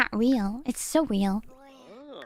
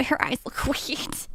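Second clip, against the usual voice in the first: whispering; 4 semitones lower, 5 dB quieter than usual. Real, not acted. Whispered aside.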